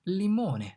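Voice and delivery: limone with Italian vowels, li-MO-ne; Italian word. Shown as a demonstration. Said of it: "these are the correct answers".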